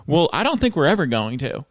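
ordinary talk

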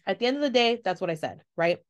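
usual speaking voice

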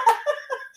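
bright high burst of laughter; real high laugh. Real, not acted. Cohost laughs.

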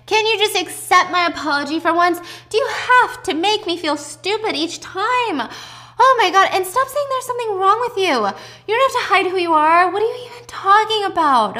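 high, loud, acted tirade at a loved one; exasperated outburst. Mother snapping at her daughter after a long day, high and exasperated.

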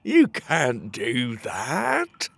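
very slow, drawn-out guard voice; guard objecting. Acted. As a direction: stretch each word like a dim guard.

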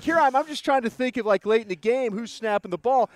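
high-pitched, retold self-defence; defensive protest. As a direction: justify yourself, starting high and loud. Retold defensive reply after being scolded.

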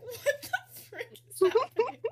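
high, loud, slow, laugh-choked; laughing disbelief. Retold or imitated. Professor stunned by crying pupil, retold through laughter. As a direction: cry it out baffled while cracking up.

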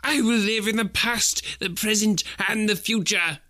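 high, eager vow; Scrooge repeating. A narrator's reading. Scrooge scrambling out of bed, vowing eagerly. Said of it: "Scrooge repeated".

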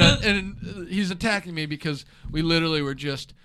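ordinary talk, nothing marked

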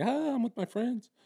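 high-pitched mock party girl; drinking girl imitation. Guest imitating a woman drinking at a bar, mock squeal. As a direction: squeal it lightly as a tipsy girl. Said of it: "'Cause a bitch is drinking".

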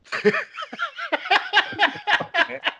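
high burst of real laughter. Real, not acted. Laughing at the dungeon master's dull call, high laugh. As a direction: let a big laugh out.

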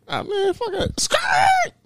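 very high imitated tire screech; cartoonish high-pitched skirt noise; dismissive getaway. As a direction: brush it off, then screech away like a car. Acts speeding off with tire screech.

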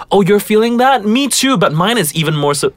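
high, exaggerated me-too quote; mock a friend who has to top everything; high-pitched one-upping; one-upping reply. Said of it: "I've never said".

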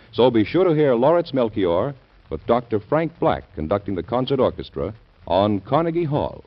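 ordinary delivery

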